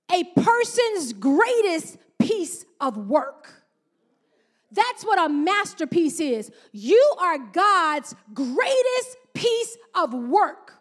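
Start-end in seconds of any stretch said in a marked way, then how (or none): 0.1-3.6 very slow, deliberate quoting; slow definition read. Shown as a demonstration. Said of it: "Dictionary dot com says". 6.7-10.7 very slow, rising emphasis on "You"; slow declaration. Acted. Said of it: "declaring to congregation".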